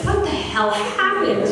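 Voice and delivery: high-pitched baffled question; retold comic alarm; mock bewilderment. Startled man finds her crying.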